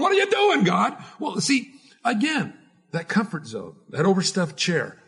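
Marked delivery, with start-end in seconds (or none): 0.0-1.0 panicked protest. Retold or imitated. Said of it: "We're going".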